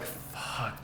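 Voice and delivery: very soft, voiceless curse; stunned near-whisper; hushed dismay. Retold or imitated. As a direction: breathe it out, appalled. Retelling her stunned reaction to sketch.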